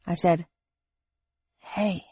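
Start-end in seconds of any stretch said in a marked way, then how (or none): 1.6-2.1 faint, much softer hey; barely voiced, weak. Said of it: "said Thursday in a weak voice".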